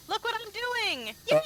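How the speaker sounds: high, gleeful imitation of a show-off; mock excited showing off. Imagined VTuber showing off to viewers, mock-excited. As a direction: squeal it like an over-eager performer.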